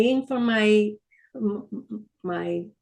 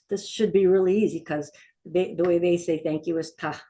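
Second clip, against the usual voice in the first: ordinary delivery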